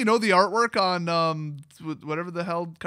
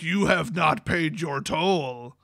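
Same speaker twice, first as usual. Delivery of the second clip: god's voice; slower than usual. Retold or imitated. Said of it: "Bantu's like".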